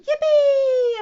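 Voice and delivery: high, loud, drawn-out cheer; whooping high with delight; gleeful yell. A narrator's reading. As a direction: yell it out for the whole crowd. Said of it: "everybody yelled".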